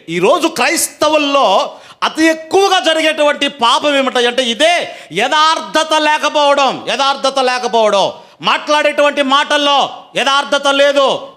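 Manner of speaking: high, insistent preacher's delivery; forceful preaching. Real, not acted. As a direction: drive each point home to the congregation. Preaches at raised pitch. (ఉద్వేగంగా ప్రసంగం)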